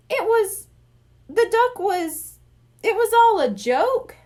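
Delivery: stunned disbelief. Acted. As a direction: stammer it out, thrown by the news. Kid stunned by the trick.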